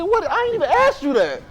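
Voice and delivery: high, incredulous comeback; baffled. Retold or imitated. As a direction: act puzzled at a pointless answer. His baffled reply.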